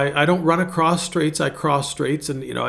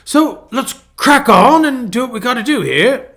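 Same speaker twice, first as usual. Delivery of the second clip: high-pitched British imitation, building louder; mock British accent; 8 semitones higher, 4 dB louder than usual. Pastor imitating a renovator cracking on, mock British accent. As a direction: play the keen Brit in a put-on accent. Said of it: "he, he says".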